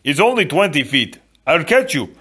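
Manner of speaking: high, loud, rising in pitch; Fezzik, helpful giant. A narrator's reading. Fezzik offers to catch his friends, eager and loud. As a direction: offer help in a big eager voice.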